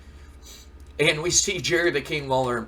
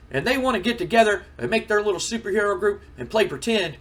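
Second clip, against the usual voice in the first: scornful rant; 8 semitones higher, 4 dB louder than usual. Acted. Lawler mocking the Hurricane.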